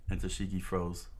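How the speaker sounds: usual speaking voice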